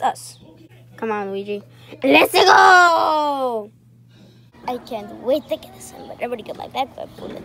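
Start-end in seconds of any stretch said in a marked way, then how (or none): 2.0-3.7 loud cheer; excited shout. Acted. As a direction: whoop it out. Cheering into the pool.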